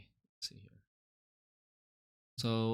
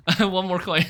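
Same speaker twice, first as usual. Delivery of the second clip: laughing with relief, louder; relieved laughing; 6 semitones higher, 8 dB louder than usual. Real, not acted.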